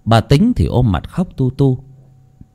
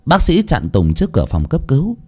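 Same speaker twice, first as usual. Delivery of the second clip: ordinary talk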